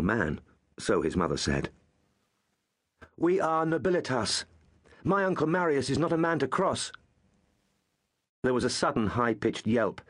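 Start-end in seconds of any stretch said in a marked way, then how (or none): 3.2-6.9 lightly voiced boy, proud and defiant. Young Gaius warning the thugs, lightly voiced proud boast. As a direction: puff up like a noble child.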